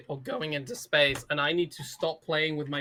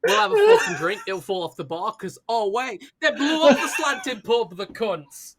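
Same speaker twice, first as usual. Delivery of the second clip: hammed-up Brummie accent, bursting much higher and louder; mock Brummie; 5 semitones higher, 8 dB louder than usual. Defending Birmingham to a friend in a loud mock Brummie accent. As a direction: ham up a Birmingham pub lad. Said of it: "your, like, attempt to do Brommy".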